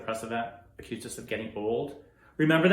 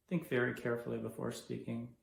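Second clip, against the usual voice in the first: ordinary delivery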